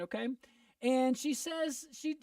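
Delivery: normal talking voice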